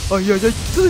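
very high, loud frantic cries; real frightened screaming; panicked screams. Real, not acted. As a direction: shriek get away in panic. Chased by enemy.

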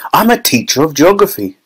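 mild cry. A narrator's reading. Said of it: "cried Missus Salt".

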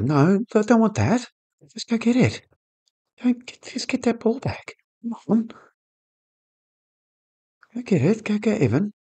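high, pleading yells at the team; frustrated urging. Real, not acted. Gamer yelling at his players on screen, high frustrated pleading. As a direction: beg the players to win the ball back.

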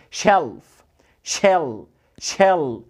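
"شيلف" with a dark, held L, voice falling. Shown as a demonstration. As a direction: hold a dark English L in "شيل". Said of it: "ال دي دارك ال".

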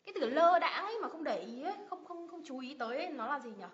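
usual speaking voice

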